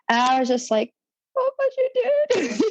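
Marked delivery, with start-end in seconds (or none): 1.4-2.6 very high, squeaky mock-weepy surprise; hammed-up high-pitched disbelief; surprised, tearful. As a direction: imitate a touched, astonished squeak. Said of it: "I was just really surprised".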